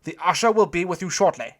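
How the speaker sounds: put-on loud, high German-accented announcement. A narrator's reading. German doorman addressing the queue, loud officious announcement. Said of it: "then turned to address us".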